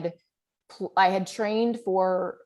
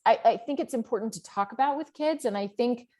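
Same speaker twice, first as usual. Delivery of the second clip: ordinary talk, nothing marked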